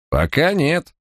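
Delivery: a touch of amused mockery, voice lifting; smirking. A narrator's reading. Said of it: "усмехнулся Барак".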